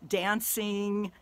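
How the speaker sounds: ordinary delivery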